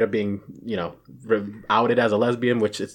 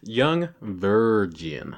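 very slow, each word deliberate; slow careful correction; much slower than usual. Shown as a demonstration. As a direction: spell it out slowly. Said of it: "Damn it".

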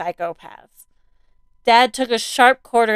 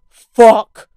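usual speaking voice

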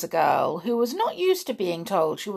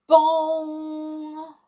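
loud, high ringing bong; clock bell chime; 9 semitones higher, 4 dB louder than usual. A narrator's reading. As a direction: imitate Big Ben, letting the note ring out. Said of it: "Ben began to chime".

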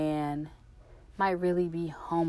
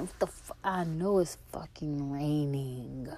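slow, annoyed whispered grumble; irritated whisper; slower than usual. Retold or imitated. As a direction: grumble it under your breath, irritated. Irritated reply to mom.

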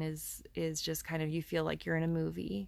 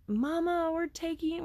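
put-on much higher, excited child's voice; excited little kid; 11 semitones higher than usual. Son calling out to mama on the train, higher and excited. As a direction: mimic a thrilled little boy. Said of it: "He's just exclaiming".

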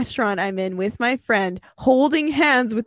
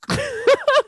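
squealing, helpless laughter; can't stop laughing, high; high laughing fit. Real, not acted.

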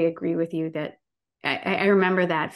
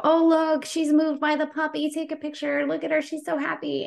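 much higher, sing-song mock of people gushing; mocking the tourists; 10 semitones higher than usual. Tourists think she is moved by the puppy, high mocking sing-song. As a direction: parody them cheerfully misreading her tears. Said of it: "they go".

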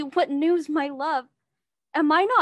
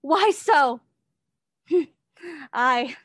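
hammy stage actor. Acted. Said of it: "you puppet".

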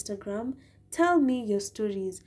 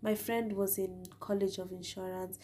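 normal talking voice

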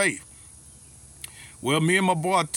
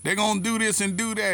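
imitating a grumbling old-school guy, pitched higher; negative naysayer; 5 semitones higher than usual. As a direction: voice the office pessimist in a higher tone. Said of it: "He always be in there negative".

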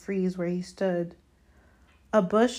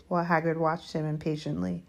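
plain reading voice

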